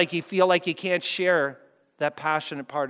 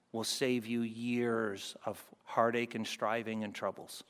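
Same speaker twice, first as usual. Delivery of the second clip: ordinary talk, nothing marked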